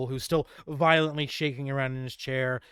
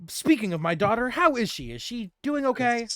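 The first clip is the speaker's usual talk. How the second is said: lightly voiced anxious king; 8 semitones higher than usual. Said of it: "he says".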